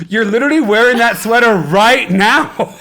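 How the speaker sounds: high, loud "literally" with a rising tune; students calling him out. Retold or imitated. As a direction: catch the teacher lying, loud and high. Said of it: "And they go".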